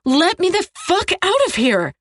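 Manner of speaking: high-pitched furious yelling. A narrator's reading. Captive demanding release from her captor, yelling. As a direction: yell the demand with all your outrage. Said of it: "I yell".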